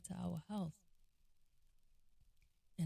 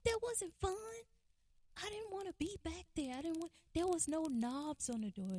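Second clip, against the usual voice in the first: very high, whiny tearful voice; mock-crying protest, pitched way up; imitated sobbing; 11 semitones higher than usual. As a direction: squeak it out like an upset boy, imitated. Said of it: "he just kinda sobbed and cried".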